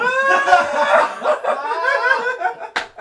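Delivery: loud, high-pitched cackling laughter; big laugh. Real, not acted. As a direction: burst out howling with laughter. Cracking up after his own joke.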